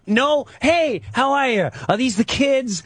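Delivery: high, hurried mock-friendly greeting; acted alarmed politeness. Homeowner heading off creepy neighbor before his kids, high forced friendliness. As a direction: jump up high to head him off.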